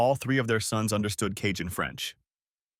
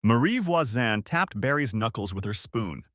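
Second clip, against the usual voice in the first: ordinary delivery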